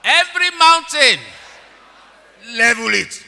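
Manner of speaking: high, loud shouted declaration; fervent shouted prayer. Shown as a demonstration. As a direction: shout it for them to repeat. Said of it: "Can you say after me".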